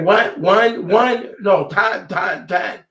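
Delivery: baffled at the nurse's count, he laughs and shouts back; confused outburst. Retold or imitated. Loud, laughing bewilderment.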